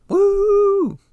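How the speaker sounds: high, loud happy whoop; excited whoop. Real, not acted. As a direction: let out a joyful cheer. Cheering about a holiday.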